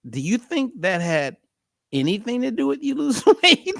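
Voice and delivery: asked breaking into laughter; laughing question. Retold or imitated. [name] asking Smiley about his diet, laughing at the end. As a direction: let the laugh break through the last word. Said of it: "And I said".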